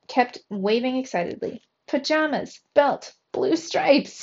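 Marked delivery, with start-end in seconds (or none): 1.9-4.2 high, slow, excited cry with each word spaced out. A narrator's reading. Trap crying out his sail idea, excited and slow. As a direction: spell out the brilliant idea in bursts. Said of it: "he cried".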